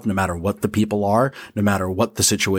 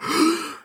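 acted gasp for air. Head pops above water.

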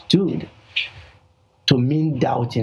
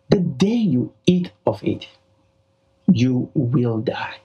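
"you will die" slow after a long pause; deliberate, weighty warning; emphatic. Retold or imitated. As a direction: make "you will die" sound inescapable. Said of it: "It is emphatic. It is inescapable".

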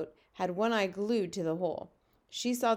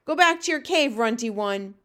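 shouted order; 3 semitones higher, 8 dB louder than usual. A narrator's reading. Giants sending the runty one home, shouting. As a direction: shout him away with contempt.

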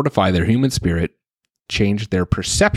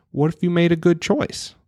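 high-pitched coaxing question; imitated parent voice, pitched up; parent to child; 9 semitones higher than usual. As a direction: talk down gently to a little kid. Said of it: "what I tell my kids".

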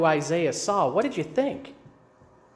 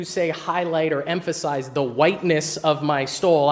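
ordinary talk

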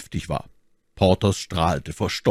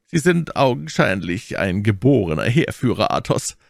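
raised, louder exclamation of admiration; calling out; 5 semitones higher, 5 dB louder than usual. A narrator's reading. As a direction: exclaim it to a comrade, voice lifted. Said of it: "rief Aramis".

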